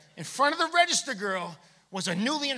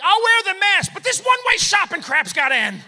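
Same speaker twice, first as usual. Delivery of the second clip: loud mock rant, higher; angry shopper; 8 semitones higher, 9 dB louder than usual. Shopper ranting against one-way aisles, loud mock anger. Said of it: "They're like".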